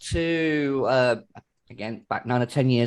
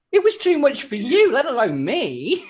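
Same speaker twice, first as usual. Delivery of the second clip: high, loud comic protest; mock indignant retort; 10 semitones higher, 5 dB louder than usual. Son retells his retort to squeamish parents, mock indignant. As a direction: protest in an exaggerated high, raised voice.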